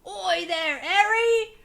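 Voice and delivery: loud, high West Country call; Hagrid accent. Retold or imitated. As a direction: bellow it like Hagrid. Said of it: "she writes that in".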